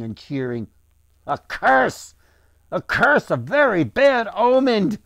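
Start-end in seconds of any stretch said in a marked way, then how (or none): 1.2-5.0 loud, high cries of dread; alarmed cry. A narrator's reading. Horrified islander warns before fleeing the square, alarmed cry. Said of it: "cried another islander".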